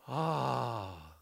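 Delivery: sharp gasp, then a long sighed "ah"; put-on gasp and drawn-out ah; mock awed gasp. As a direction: gasp as a whole crowd would, then sigh it out. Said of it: "And then we all go".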